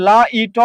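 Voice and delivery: "লা ইট" as la, i, to, the t given its own o; separated final consonant. Shown as a demonstration. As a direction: pull the t of "লা ইট" into a third open syllable. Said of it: "ত্বকে আলাদা করেন".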